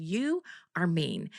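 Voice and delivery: slow, soft, word by word; hurt accusation. Retold or imitated. Crying customer telling the nail tech off, retold slow and quiet. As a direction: drop the volume and space out each word. Retelling her tearful accusation.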